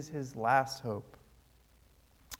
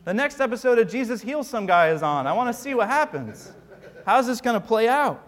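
mock-eager crowd, higher and louder; eager gawker; 6 semitones higher, 6 dB louder than usual. Pastor mimics the crowd treating Jesus like a show, eager. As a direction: play a thrill-seeker grabbing popcorn. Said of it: "people are like".

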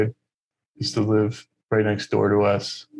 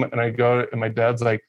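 ordinary talk

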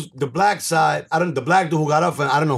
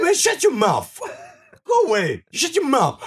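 higher-pitched mock telling-off; heated retort, voice raised in pitch; imitated angry dismissal; 7 semitones higher than usual. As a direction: act out ordering someone to shut up. Acts opponent telling him off.

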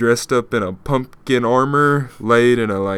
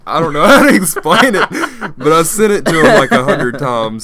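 giggling through the words; can barely get it out for laughing; 4 semitones higher, 5 dB louder than usual. Real, not acted. Friend explaining the prank, laughing hard. Telling a funny prank story.

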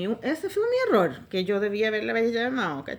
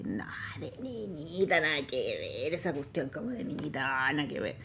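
mock dismissive editor voice; 10 semitones lower, 8 dB quieter than usual. Owner voiced dismissing pink.

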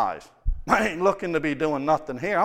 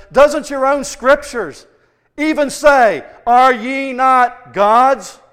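loud, rising sermon cry; preaching at full force; 7 semitones higher, 10 dB louder than usual. Real, not acted. Preacher pressing the point on the congregation at full force. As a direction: drive it home to the congregation.

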